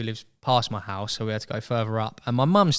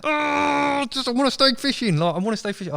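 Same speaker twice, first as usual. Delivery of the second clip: put-on high-pitched, louder childish whine, fading out; whiny pleading; 14 semitones higher, 4 dB louder than usual. Pleading with his mate to stay fishing, a high whine. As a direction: wail like a kid who won't leave. Begging friend to keep fishing.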